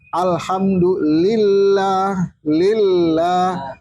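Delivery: ALHAMDULILLAH slow, double L held in lil-lah; tasdid on L. Shown as a demonstration. Teacher modelling tasdid on lam, slow held L. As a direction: dwell on the L of "lillah". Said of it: "fauqa lamil jalal".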